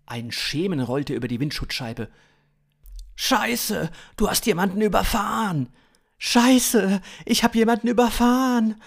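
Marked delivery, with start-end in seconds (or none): 3.2-8.8 high, loud, rushed alarm; frantic high-pitched shouting; acted panic. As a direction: cry out in horror, building louder. Two characters panic after hitting someone.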